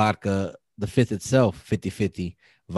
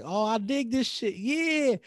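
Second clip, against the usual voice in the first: higher, delighted mock cheer; 12 semitones higher, slower than usual. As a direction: sound pleasantly surprised. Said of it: "And I'm like".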